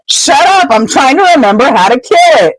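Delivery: high, stressed-out snap; acted-out flustered scolding; exasperated retort. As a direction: shut them up while thinking hard. Acted quarrel under danger.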